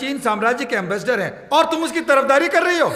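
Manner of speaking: loud, high, outraged scolding; angry shouting. Acted. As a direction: berate them at the top of your voice. Furious man berates woman, denounces rebel.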